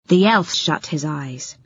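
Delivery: ordinary delivery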